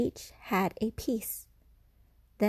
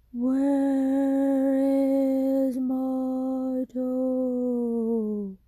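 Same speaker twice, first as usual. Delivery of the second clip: slow, drawn-out ghostly moan, higher and louder; ghostly groan; 5 semitones higher, 5 dB louder, much slower than usual. Acted. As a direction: stretch every word like a ghost calling from the dark. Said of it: "the voice groaned".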